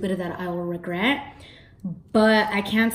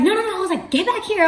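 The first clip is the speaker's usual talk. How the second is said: high pleading cry; pained pleading; 12 semitones higher, 4 dB louder than usual. Retold or imitated. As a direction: beg him to stay. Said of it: "I was in pain".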